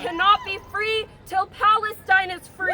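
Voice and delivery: loud, rising rally cry; fired-up protest shout. Real, not acted. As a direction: shout it out to the crowd. Rally chant line, shouted.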